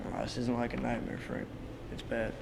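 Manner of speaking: whispered, barely voiced; hushed fear. [name] whispers his fear to Frank, hushed. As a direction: breathe it out, scared to say it.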